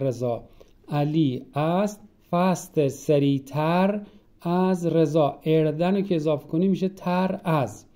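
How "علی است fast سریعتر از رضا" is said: slow, deliberate word-by-word translation; slow word-by-word gloss. Shown as a demonstration. Teacher to class, slow word-for-word gloss. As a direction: lay each word down slowly.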